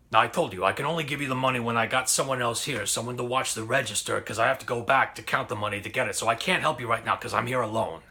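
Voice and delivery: quick, brusque boss excuse, fast; impatient boss. Retold or imitated. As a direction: rattle off the excuse like a curt shop owner.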